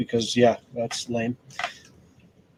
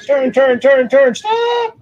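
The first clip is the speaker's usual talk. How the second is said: loud, rising calls to a driver; calling out loud; 11 semitones higher, 4 dB louder, much slower than usual. Real, not acted. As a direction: shout the directions across the yard.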